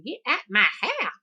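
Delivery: high, loud granny scolding; granny's saying. Retold or imitated. Granny shooing the kids, loud and high. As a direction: shoo them off like granny did. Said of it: "That's the thing they used to say".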